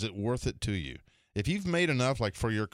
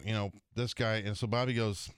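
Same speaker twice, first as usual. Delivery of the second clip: ordinary delivery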